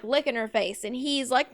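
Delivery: ordinary delivery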